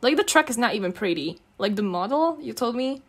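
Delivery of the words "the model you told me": ordinary delivery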